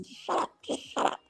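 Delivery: a desperate whisper so nobody hears; whispered hush. Retold or imitated. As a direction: whisper it urgently to the kid. Hushing the daughter in the store.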